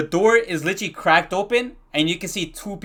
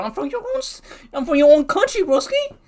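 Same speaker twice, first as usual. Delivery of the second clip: very high, squeaky plea; incredulous appeal; 9 semitones higher than usual. Retold or imitated. Student appealing to principal from his own country, incredulous. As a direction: plead in disbelief, voice shooting way up.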